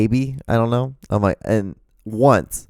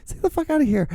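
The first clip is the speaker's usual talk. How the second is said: high-pitched incredulous pleading; mock urging in a much higher voice; exasperated urging; 9 semitones higher than usual. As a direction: squeal it like you're shooing him away. Acted exasperated shooing in retold story.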